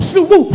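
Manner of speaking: a rising swoosh for the miss; swoop sound. Retold or imitated. Preacher mimics bat missing, mock swoosh. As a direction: mimic the bat whiffing the air.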